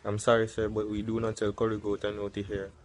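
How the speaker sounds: a deep, patient cashier brushing him off; calm counter worker. Acted. Fast food worker refusing customer.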